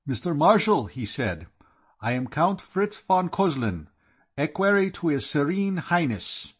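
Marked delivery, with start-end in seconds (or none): 0.1-0.9 put-on German accent, formal and raised. A narrator's reading. Equerry greeting his host in a German accent. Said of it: "He spoke with a German accent". 2.0-6.5 put-on German accent. A narrator's reading. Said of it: "He spoke with a German accent".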